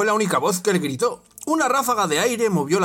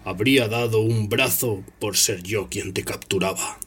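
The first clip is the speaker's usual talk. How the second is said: Snape's voice; 7 semitones lower than usual. Acted. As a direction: savour the revenge.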